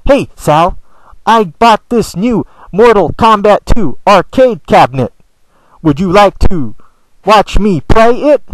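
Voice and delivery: slow, with long pauses between phrases; Rob's pausing way of talking. Retold or imitated. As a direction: drag it out, stopping between chunks like Rob. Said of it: "he pauses".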